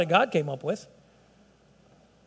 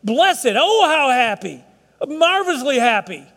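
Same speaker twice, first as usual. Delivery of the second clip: 声音抬高变响，热情高呼；发自内心地赞叹"Blessed"；满怀激情地强调幸福; emphatic joy; 5 semitones higher, 4 dB louder, slower than usual. Shown as a demonstration. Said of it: "there's an emphatic".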